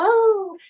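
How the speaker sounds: high, sudden pained "oh"; a sharp cry as her hand is grabbed; pained gasp. Retold or imitated. Dying woman touched by her grandchild, a high pained oh. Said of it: "she'd go".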